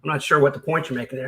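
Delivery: ordinary delivery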